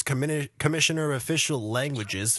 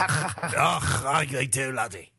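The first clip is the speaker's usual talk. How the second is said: throaty mock Scottish burr; Scottish accent; slower than usual. Co-host demonstrating throat-clearing Celtic speech, mock Scottish accent. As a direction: put on a comic Scottish accent. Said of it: "You did a Scottish accent there".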